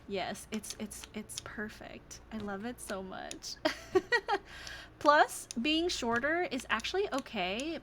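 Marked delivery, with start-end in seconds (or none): 0.1-3.5 soft breathless whisper; barely voiced, almost to herself. Real, not acted. Streamer overwhelmed by her birthday surprise, whispering.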